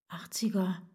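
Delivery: unvoiced whispered aside while picking a record; hushed mutter. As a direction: whisper it to yourself. Muttering while choosing music.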